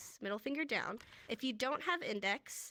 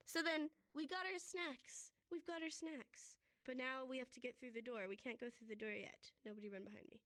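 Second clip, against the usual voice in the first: hushed; 10 dB quieter than usual. Real, not acted. Speaker suddenly drops to hush.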